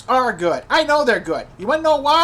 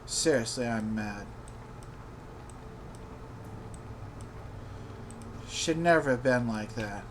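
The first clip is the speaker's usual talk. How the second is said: low, subdued simmering anger; anger held down to a low voice; quietly mad; 7 semitones lower, 11 dB quieter than usual. Real, not acted. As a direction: grumble it low and softly, still angry. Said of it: "I'm really mad".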